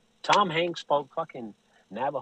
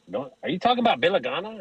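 incredulous question; 7 semitones higher, 4 dB louder, much faster than usual. Retold or imitated. Listeners questioning his misheard name.

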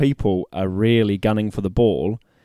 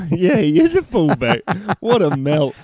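laughing through the words, higher pitched; real laughter; 6 semitones higher than usual. Real, not acted. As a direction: laugh helplessly at your own mix-up. Laughing at own mix-up.

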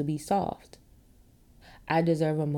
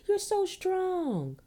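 much higher, slow sing-song "You're so strong"; mock soothing; 13 semitones higher, much slower than usual. Mocking friends who tell a crier "You're so strong", high slow mock soothing. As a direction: drag out "You're so strong" like a patronizing pat on the head. Mocking comforters, end of quote.

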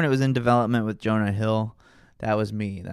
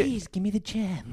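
put-on high, slow, soft baby whine; Adam Sandler baby voice; 10 semitones higher, 5 dB quieter than usual. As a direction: whimper it like a goofy man-child. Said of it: "the baby voice once in Uncut Gems".

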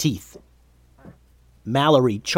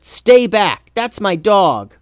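high, raised-voice warning; protective warning; 7 semitones higher, 5 dB louder, slower than usual. A narrator's reading. Calvin lifting his scepter at the goblins, protective loud warning. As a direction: warn them off your dog.

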